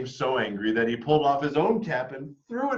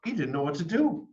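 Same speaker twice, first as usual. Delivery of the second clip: ordinary delivery, nothing marked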